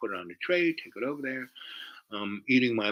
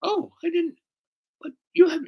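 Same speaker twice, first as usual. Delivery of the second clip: high falsetto imitation of a girl; squeaky, faltering mock-girl voice; girl's voice; 3 dB louder than usual. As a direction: protest haltingly in a pitched-up voice. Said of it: "and she goes".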